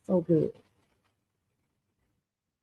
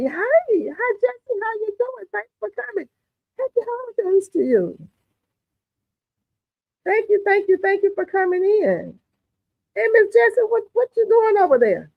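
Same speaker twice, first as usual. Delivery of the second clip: bright high chirpy welcome, much higher than usual; high sing-song greeting; 13 semitones higher than usual. Real, not acted. Host greeting viewer Jesse in the live chat, high sing-song welcome.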